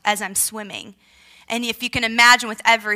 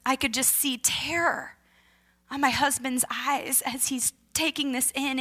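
normal talking voice

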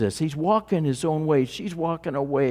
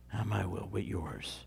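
barely spoken, softer still, fading away; fading echo; 7 semitones lower, 15 dB quieter than usual. Acted. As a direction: let it sink to almost nothing. Echoing the prayer even softer.

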